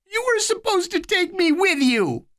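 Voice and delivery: put-on high, small child's voice; a scared little kid, pitched high; little boy voice. A narrator's reading. Little Eddie pleading with his big brother, high childlike voice.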